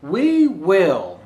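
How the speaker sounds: bright, lilting storybook voice; gentle animated read-aloud; reading to children. Acted. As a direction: read it like a picture book to little kids. Reads picture book aloud.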